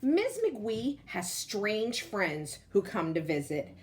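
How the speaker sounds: usual speaking voice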